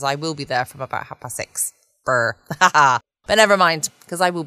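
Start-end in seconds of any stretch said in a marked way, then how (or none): 2.0-2.4 mock shiver from the cold; rolled lips, teeth-chattering brr. Cold at station at dawn.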